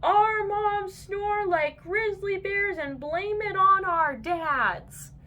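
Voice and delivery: slow, high, loud announcement; yelled. A narrator's reading. Boys shout their moms' secret to the whole mall, slow and loud. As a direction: yell it across the mall slowly. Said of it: "and yelled".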